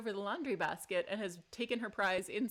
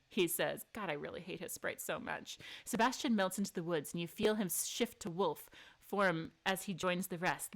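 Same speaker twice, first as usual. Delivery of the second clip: normal talking voice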